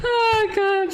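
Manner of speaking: breaking into high laughter; giggling at herself mid-sentence; laughing. Real, not acted. Laughing at the thing on her face.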